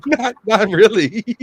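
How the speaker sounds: high, laughing through the words; giggling while telling it; real laughter breaking the telling. Real, not acted.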